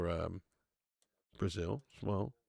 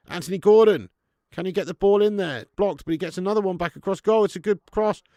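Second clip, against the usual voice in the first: higher, louder play-by-play; living room commentator getting carried away; excited commentary; 7 semitones higher, 11 dB louder than usual. Real, not acted. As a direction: call the attack as it unfolds. Live reaction to England attack.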